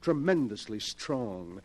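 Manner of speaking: ordinary talk, nothing marked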